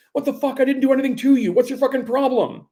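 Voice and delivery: high, loud yell of disbelief. Acted. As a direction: shout back at the raging driver. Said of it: "I yell".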